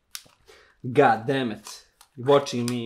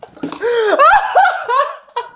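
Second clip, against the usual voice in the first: screaming laugh; 6 dB louder than usual. Real, not acted. Reacting to gross video.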